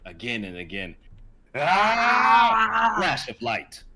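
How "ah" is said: huge high war cry; battle scream. Acted. Liam and Chester charge each other, a piercing battle scream. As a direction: scream as the swords meet.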